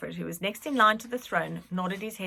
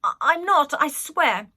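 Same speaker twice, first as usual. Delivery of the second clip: frightened denial; 8 semitones higher, 10 dB louder, slower than usual. A narrator's reading.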